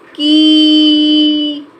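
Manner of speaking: put-on drawn-out long ee vowel for children. Shown as a demonstration. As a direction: stretch the vowel of की long and loud. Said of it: "देर तक बोलेंगे".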